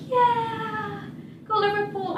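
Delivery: high, half-hearted mock cheer; fake enthusiasm. Telling pub regulars she supports Liverpool, mock cheer. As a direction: pretend to care about the team.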